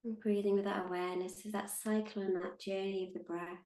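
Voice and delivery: calm, unhurried meditation guide with long pauses; measured, soothing meditation voice; guided meditation. Acted. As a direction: guide them gently into stillness, leaving space between phrases.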